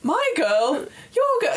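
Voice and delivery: high, laughing back-and-forth; laughing mock squabble. Brothers squabbling over the girl, laughing high. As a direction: bicker through a laugh, rising. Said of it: "they're like".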